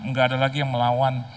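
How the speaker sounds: ordinary delivery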